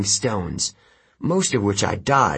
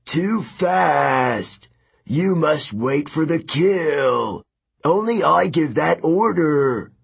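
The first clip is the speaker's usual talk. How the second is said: angry giant; 6 semitones higher, slower than usual. A narrator's reading. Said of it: "Antaeus bellowed".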